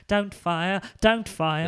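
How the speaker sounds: a panicky Bowie plea; nervous [name]. Pretend. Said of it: "You sound like a very nervous [name]".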